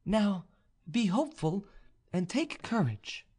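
high voice, slowing to the end; encouraging noblewoman. A narrator's reading. Vaninka encourages the young officer on parting, high and measured. Heroine encourages departing suitor.

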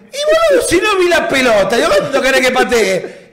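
high-pitched indignant retort; exasperated protest. Retold or imitated. Player defending himself to his teammate, exasperated and high.

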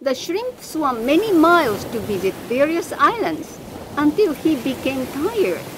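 ordinary delivery

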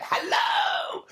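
huge, high shouted hello; over-the-top performer's holler, mocking; mock stage greeting. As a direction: project wildly to the back of a theatre. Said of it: "everyone went on and went".

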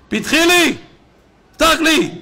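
loud, high-pitched plea to open up; urgent calling out. Acted. God knocks at the sleeper's door, calling out urgently. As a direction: cry out as if knocking at a shut door.

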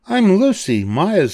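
put-on high girl's voice, slowing; timid stutter. A narrator's reading. Lucy introducing herself to monsters, high timid voice. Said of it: "she stuttered".

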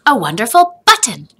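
a grandpa's proud surprise; excited reveal. A narrator's reading. Grandfather reveals the button to Joseph.

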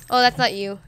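normal talking voice

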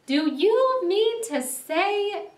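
high, loud, slow disbelief; incredulous cry. A narrator's reading. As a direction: cry out slowly, exasperated with a friend. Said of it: "cried the rat".

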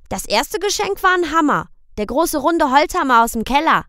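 put-on high, loud little boy's question; high child's voice; child asking. A narrator's reading. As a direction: ask papa like a worried small boy. Boy Michel tells.

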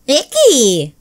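put-on a squeamish kid's "icky"; grossed out. Sid still smells the diaper, disgusted. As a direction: wrinkle your nose and spit it out.

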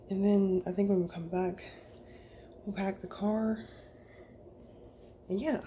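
breathless after running downstairs; puffing between words; out of breath. Real, not acted. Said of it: "sorry I'm out of breath 'cause".

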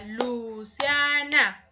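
Luciana spoken slowly, syllable by syllable; syllables drawn out. Shown as a demonstration. Teacher to class, sounding out Luciana slowly. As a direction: stretch Luciana out as Lu-cia-na. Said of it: "tiene tres sílabas".

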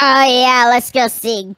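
usual speaking voice

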